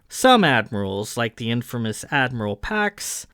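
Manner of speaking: ordinary delivery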